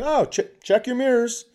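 high-pitched, chirpy heads-up; breezy quip. Retold or imitated. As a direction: call it out lightly in passing. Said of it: "thinking that was kind of a nice thing".

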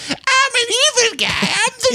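very high, strained hero voice; a nice guy badly faking a villain; polished Jimmy Stewart playing evil. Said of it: "polished Jimmy Stewart".

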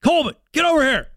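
high, quick mock holler from a coach; coach calling out. Coach to player during the game, imitated quick call-over. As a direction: summon a player as a coach would. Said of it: "it's usually like this".

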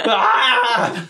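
high strained scream; battle scream. Acted. Player's character battling a swordsman, high scream. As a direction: scream out mid-fight.

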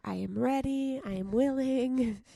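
mantra. Acted. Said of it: "that's my mantra for today".

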